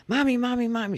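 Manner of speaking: put-on high, loud child's call for mommy; little boy calling. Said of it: "goes out".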